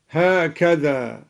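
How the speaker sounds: ordinary delivery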